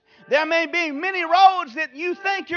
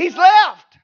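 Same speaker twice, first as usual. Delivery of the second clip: shouted; 4 semitones higher, 9 dB louder than usual. Acted. Preacher voicing angel, shouting.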